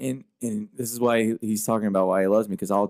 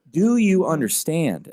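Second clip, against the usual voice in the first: higher, slow, pressing repeat of the question; insistent repeat; 6 semitones higher, 3 dB louder, slower than usual. Retold or imitated. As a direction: lean in and press the question harder. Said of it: "I'll go".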